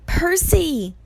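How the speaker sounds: high, loud cry of a name; calling out. A narrator's reading. Grover calls to Percy from the air, urgently. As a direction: call urgently from a distance.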